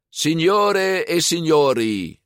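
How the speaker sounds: grand slow announcement, high and loud; calling out. A narrator's reading. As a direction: hail the whole crowd. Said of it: "riep signora Marsigliese".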